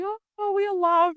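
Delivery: high, gushing imitation of a blissed-out husband; mocking husband. Wife imitating her husband's gentle awakening, high and gushing. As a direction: lightly send up his dreamy bliss. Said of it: "my husband had his nice and s".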